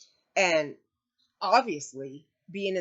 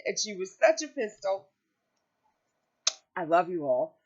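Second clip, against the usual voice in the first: ordinary talk, nothing marked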